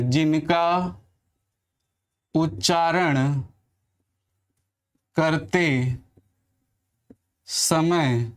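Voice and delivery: words spaced out with long pauses; slow dictation. Shown as a demonstration. Teacher dictating the definition word by word to students. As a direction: dictate each word slowly, repeating. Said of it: "मैं पहले परिभाषा लिख दे रहा हूँ".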